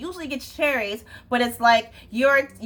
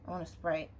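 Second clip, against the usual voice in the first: barely audible, much softer low mumble; teen mumbling; 7 semitones lower, 14 dB quieter than usual. Retold or imitated. Server mimicking a teenager ordering, much softer mumble. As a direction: mumble it under your breath like a shy teen. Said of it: "They'll mumble".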